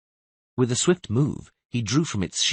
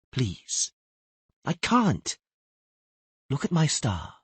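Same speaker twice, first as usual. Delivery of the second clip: put-on girl's voice, small and higher; lightly voiced young girl; 5 semitones higher than usual. A narrator's reading. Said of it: "in a quiet voice".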